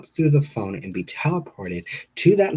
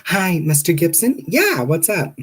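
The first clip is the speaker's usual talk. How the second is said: high, louder receptionist impression; imitated caller, pitched up; 8 semitones higher, 4 dB louder than usual. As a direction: put on a phone-desk voice. Receptionist voiced in retold call.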